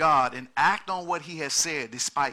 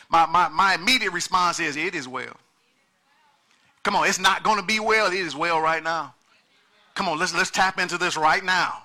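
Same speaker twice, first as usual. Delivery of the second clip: preaching at full force; 3 semitones higher, 8 dB louder than usual. Acted. Pastor pressing the congregation on currency, full-force preaching.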